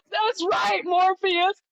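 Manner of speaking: high, loud, amazed reply to Morpheus; answering Morpheus in a high, thrilled voice; excited exclaim. Retold or imitated. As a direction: squeal it like a revelation just hit. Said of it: "I'm like".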